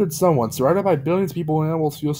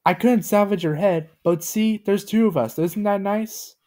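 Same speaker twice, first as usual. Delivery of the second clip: lightly voiced Gumby; 7 semitones higher than usual. A narrator's reading. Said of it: "Gumby said rather loudly".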